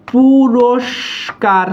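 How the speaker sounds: "পুরস্কার" stretched very slowly with a long sibilant; slow dictation-style "পুরস্কার"; drawn-out demonstration. Shown as a demonstration. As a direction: linger on each part of "পুরস্কার", pausing before the last syllable. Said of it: "এই অ আসলে হচ্ছে".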